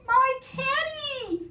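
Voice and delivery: very high, drawn-out cry. A narrator's reading. Eddie seeing the lost teddy, crying out. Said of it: "cried Eddie".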